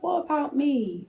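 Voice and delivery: high, plaintive little plea; inner child voice. Acted. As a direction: pipe up like a small inner child. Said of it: "this little inner child voice".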